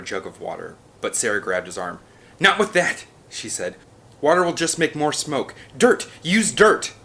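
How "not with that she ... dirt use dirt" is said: loud, high urgent orders; urgent command. A narrator's reading. Sarah stops Nick using water, loud urgent orders. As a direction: stop him and shout the fix.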